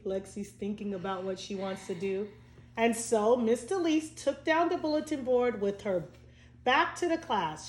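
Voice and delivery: plain reading voice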